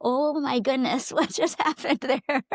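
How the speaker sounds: slow amazed words through a laugh. Retold or imitated. Parent on the stairs amazed at daughter, laughing slowly. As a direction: marvel at it, laughing. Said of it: "go down the stairs like".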